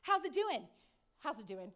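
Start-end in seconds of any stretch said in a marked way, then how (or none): 1.2-1.7 muttered repeat. Retold or imitated. Retelling the manager's greeting, muttered low and soft. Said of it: "he says".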